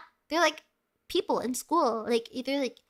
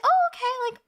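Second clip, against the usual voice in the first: high chirpy barista; 11 semitones higher, 4 dB louder than usual. Retold or imitated. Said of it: "And I was like".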